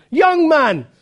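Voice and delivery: acted-out fervent shouted exhortation; Primitive Methodist shout. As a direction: shout it from the pulpit in short, punched bursts. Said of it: "he shouted, as only a primitive Methodist will do".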